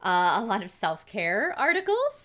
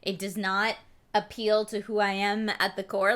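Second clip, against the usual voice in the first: normal talking voice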